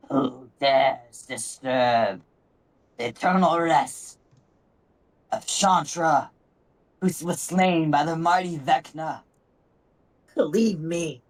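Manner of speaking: put-on raspy old hag voice, slow start. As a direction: rasp it out like an ancient dryad. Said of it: "In a raspy voice".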